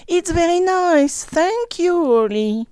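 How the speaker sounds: high, loud happy shout; children shouting. Acted. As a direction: shout it like excited kids. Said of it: "shout all together". (cri joyeux des enfants, aigu et fort)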